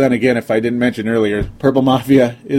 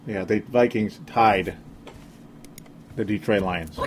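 slow, quieter, each word dropped flat; slow deadpan disbelief; 7 dB quieter than usual. Shown as a demonstration. Podcaster to fans, repeating the tie in slow disbelief. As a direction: let "tied the Detroit Lions" sink in slowly. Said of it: "I like to say Detroit again".

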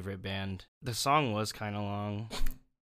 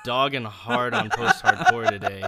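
laughing; 3 semitones higher, 9 dB louder than usual. Real, not acted. Laughing at the harsh ratings.

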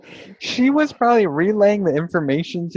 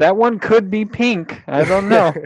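higher, louder playful cluelessness; mock oblivious; 6 semitones higher, 5 dB louder than usual. Imitating her passing red flags, playfully oblivious. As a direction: muse cluelessly, hamming it up.

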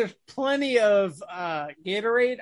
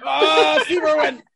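loud, higher mock moan of a name; mock climactic yell; 6 semitones higher, 6 dB louder, slower than usual. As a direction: cry out the name, hammed up. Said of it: "she just yells the wrong name".

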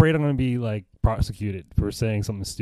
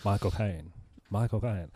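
soft go at an English actor; lightly put-on British vowels, COCAINE ending like "kine"; Michael Caine; 7 dB quieter, slower than usual. Practising the trick once more, soft Michael Caine impression. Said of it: "supposed to sound like Michael Caine".

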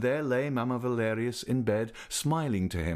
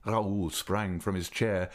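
plain reading voice

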